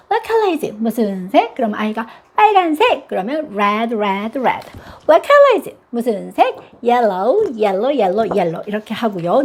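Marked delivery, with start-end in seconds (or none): 0.1-0.8 put-on high, lively question to a child; bright mom question. Shown as a demonstration. Mom quizzing her child, high and lively. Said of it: "what color is it은 엄마가 해주세요". 5.1-5.8 put-on high, lively question to a child; bright mom question. Shown as a demonstration. Mom quizzing her child, high and lively. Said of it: "엄마가 해주세요".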